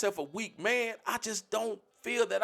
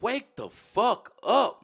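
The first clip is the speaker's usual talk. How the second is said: slow loud demand; 4 semitones higher, 5 dB louder, much slower than usual. Real, not acted. Scolding grown men in his community, slow and loud. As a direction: wake the fuck up, dragged out slow and loud.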